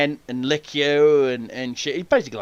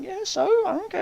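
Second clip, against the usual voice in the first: higher, softer nonchalant shrug; dismissive; 4 dB quieter than usual. Retold or imitated. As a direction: toss it off like nothing. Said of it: "I'm like".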